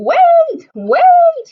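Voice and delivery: high, loud desperate yell. A narrator's reading. Froggy chasing the departing school bus, loud high yell. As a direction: shout after the leaving bus. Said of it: "he yelled".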